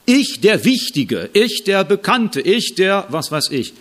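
hammed-up self-important proclamation; mock pompous boast. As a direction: strut through each grand title. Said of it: "wo Paulus das für sich sagt".

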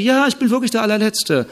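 lightly hammed-up humble servant; mock meek agreement. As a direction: agree brightly with the insult. Humble man agrees again.

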